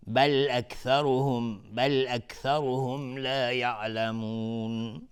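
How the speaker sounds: slow, drawn-out recitation; Quran recitation. Shown as a demonstration. Teacher reciting for students, slow and drawn out. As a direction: stretch the words like a reciter.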